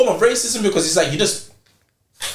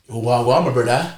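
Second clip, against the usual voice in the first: lower-pitched mock street greeting; mimicked slang greeting; 7 semitones lower than usual. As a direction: lightly imitate youths putting on slang.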